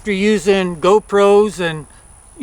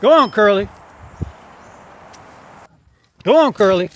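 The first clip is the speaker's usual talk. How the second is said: calling out to cattle. Real, not acted. Driving cows off.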